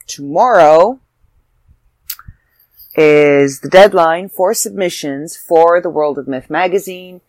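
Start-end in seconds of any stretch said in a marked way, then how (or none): none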